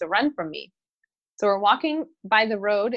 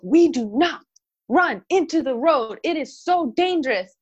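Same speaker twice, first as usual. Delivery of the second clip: firm scolding; 6 semitones higher, 4 dB louder than usual. Retold or imitated. Mom scolding daughter.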